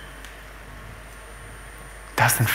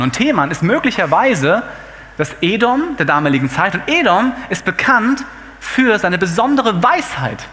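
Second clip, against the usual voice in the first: ordinary talk, nothing marked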